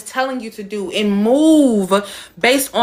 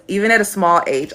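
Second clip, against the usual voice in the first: normal talking voice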